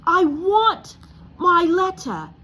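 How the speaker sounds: high, loud shout. Acted. Harry to Uncle Vernon, a high loud shout. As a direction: yell for what's yours. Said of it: "he shouted".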